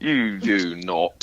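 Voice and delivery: drawn-out British attempt landing near Australian; put-on Aussie-ish British accent. Teasing a British friend back, in put-on Aussie-like British. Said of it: "That sounds more Aussie".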